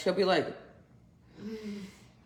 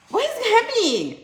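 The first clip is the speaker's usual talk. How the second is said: put-on much higher, louder baby voice; voicing the baby; 4 dB louder than usual. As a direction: complain as the baby.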